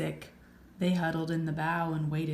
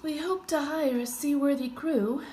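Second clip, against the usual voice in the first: captain's voice; 10 semitones higher, 6 dB louder than usual. A narrator's reading. Captain deer speaks.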